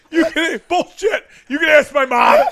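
retold indignant challenge. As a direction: imitate a high, defiant comeback. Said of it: "and Karen goes".

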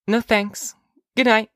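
put-on high child's voice; young girl. Daughter declining mother's offer at bedtime, high child voice. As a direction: sign off to mother in a girlish high voice.

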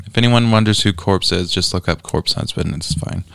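usual speaking voice

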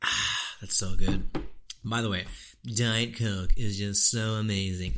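lightly voiced Trump, a bit off his own voice; a light take on Trump praising a drink; Trump impression. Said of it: "That's my Trump impression".

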